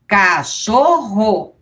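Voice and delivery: cachorro drawn out slowly; slow reading. Shown as a demonstration. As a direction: read cachorro slowly like a beginner. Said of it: "ela já coloca praticamente uma sílaba". (cachorro lido devagar)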